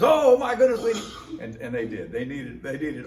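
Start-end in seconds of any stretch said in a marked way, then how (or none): 0.0-1.4 higher, louder delighted burst; eager pastor. Retold or imitated. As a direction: grab the newcomer, thrilled.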